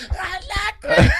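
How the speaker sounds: put-on very high-pitched kid protest; mock furious little girl. Small kid denying the nickname, high mock outrage. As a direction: imitate a tiny girl squealing in protest.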